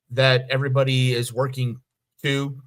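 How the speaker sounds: normal talking voice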